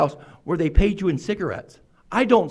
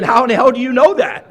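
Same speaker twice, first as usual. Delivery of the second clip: incredulous disbelief; 5 semitones higher, 4 dB louder than usual. Retold or imitated. Retold thought, newcomer singled out.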